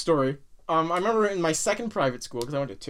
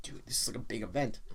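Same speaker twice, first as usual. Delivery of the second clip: mock hushed excitement; 11 semitones lower, 13 dB quieter than usual. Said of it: "it was like".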